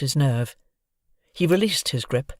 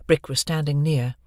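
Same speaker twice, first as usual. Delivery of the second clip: ordinary delivery, nothing marked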